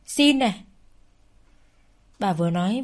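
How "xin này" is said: mocking jab, louder. Mocking her plea, louder.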